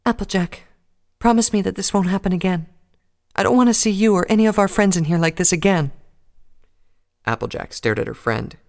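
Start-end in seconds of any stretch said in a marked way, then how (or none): 0.0-5.9 high, loud earnest plea; pleading shy pony. Acted. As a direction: beg her not to get hurt. Fluttershy pleading.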